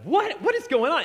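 sharp high disbelieving question; bewildered what. Retold or imitated. Disciples hearing Jesus will die, shocked and high. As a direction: leap up in pitch, baffled.

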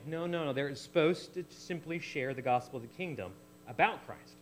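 usual speaking voice

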